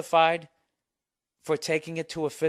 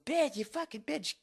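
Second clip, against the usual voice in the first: much higher, squeaky mock snarl; imitating furious woman; 12 semitones higher, 4 dB quieter than usual. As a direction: spit it out like the enraged girl. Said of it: "she just kept fucking".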